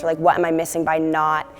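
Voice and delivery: usual speaking voice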